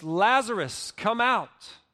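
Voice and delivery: loud cry. Retold or imitated. Jesus calls dead Lazarus out of the tomb, loud cry. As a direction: call it out commandingly, loud and high. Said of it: "he cried out with a loud voice".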